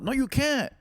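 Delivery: sharp, high-pitched no, you can't; defiant retort. Retold or imitated. Retelling the traffic stop, answering the cop with a defiant high retort.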